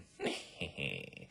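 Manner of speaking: low, airy villain giggle; sneaky snicker. Acted. Other assassin coming in, low sneaky snickering. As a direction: snicker as you creep in.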